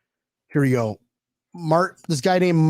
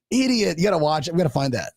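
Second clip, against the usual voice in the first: ordinary delivery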